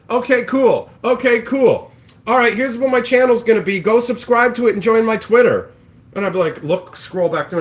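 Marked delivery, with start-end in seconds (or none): 0.1-5.7 higher, louder eager mock voice; mock eager newbie. Streamer mimicking newbies who take advice and plug channels, eager mock voice. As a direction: rattle it off like a pushy newbie.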